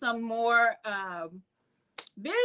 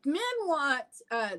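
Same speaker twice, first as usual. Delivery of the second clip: normal talking voice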